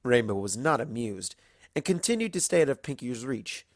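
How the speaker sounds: ordinary delivery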